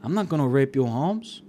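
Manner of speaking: hammed-up accented captor; roommate's menacing put-on voice. Roommate menaces captive in character.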